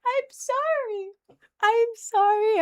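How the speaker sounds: tiny, drawn-out high voice; mock little high-pitched sorry; high-pitched apology. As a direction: squeak it out slowly, way up high.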